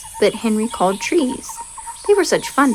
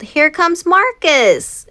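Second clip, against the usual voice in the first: slow, higher, louder announcing call; 7 semitones higher, 5 dB louder, slower than usual. Acted. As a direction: call it up the mountain. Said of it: "he called".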